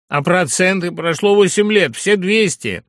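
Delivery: high, loud haggling; indignant protest. A narrator's reading. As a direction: object excitedly about money. Heated protest over a debt.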